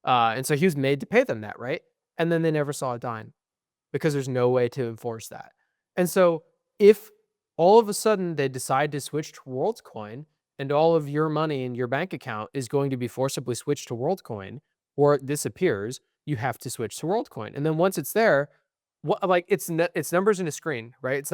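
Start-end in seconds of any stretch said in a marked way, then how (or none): none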